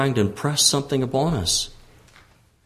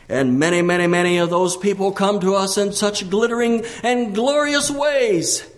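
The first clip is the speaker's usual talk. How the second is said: high-pitched, driving sermon; insistent pulpit delivery on a raised pitch; impassioned preaching; 7 semitones higher than usual. Real, not acted. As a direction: preach it with rising urgency. Preaching at full force.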